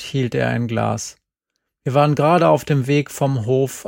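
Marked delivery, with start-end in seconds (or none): none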